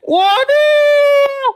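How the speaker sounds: high, loud mock gasp of shock; hammed-up waduh, high and loud. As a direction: act scandalized. Mock exclamation at the picture.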